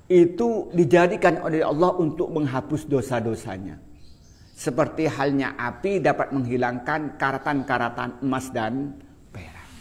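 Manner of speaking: usual speaking voice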